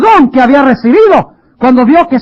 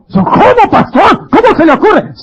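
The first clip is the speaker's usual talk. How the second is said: incredulous; 9 semitones higher than usual. Pretend. Imagined listener objecting to pastor.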